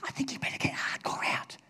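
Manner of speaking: hushed aside, no voice; whisper. Retold or imitated. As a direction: whisper it behind a hand. Adults' complaint retold quietly.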